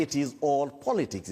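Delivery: high-pitched, emphatic assertion; heated insistence. Real, not acted. Guest dismisses minister's abduction claim, heated insistence. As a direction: press the point forcefully. Guest repeats point in argument.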